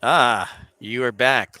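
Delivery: ordinary talk, nothing marked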